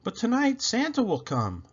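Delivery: put-on high insistent child; hopeful child. A narrator's reading. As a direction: insist like an excited kid.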